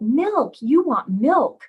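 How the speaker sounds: each "milk" loud, with a big swoop in pitch; slow, loud, sing-song stress on "milk"; modeling with intonation. Shown as a demonstration. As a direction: model "milk" for a toddler, stretched and emphatic. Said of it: "used a lot of intonation in your voice".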